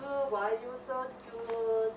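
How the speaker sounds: put-on baby talk. Owner baby-talking the cat Winnie Pooh. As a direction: coo it slow and high like to a baby.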